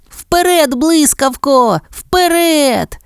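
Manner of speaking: high-pitched, drawn-out puppy cheer; excited cheering yell. A narrator's reading. Excited puppy cheering on her TV hero, high-pitched yell. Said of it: "кричала Пенні".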